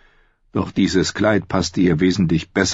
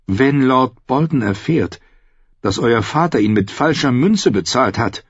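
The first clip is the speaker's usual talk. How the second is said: voiced higher as the young woman Brienne; shy woman knight voice, pitched up; Brienne's voice; 7 semitones higher than usual. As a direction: lift into Brienne's earnest higher voice. Brienne's voice, slow.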